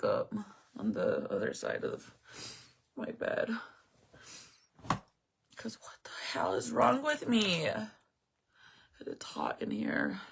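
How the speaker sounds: whispered through tears, very soft; barely voicing it, overwhelmed; tearful whisper. Real, not acted. Talking to her live viewers, a tearful whisper.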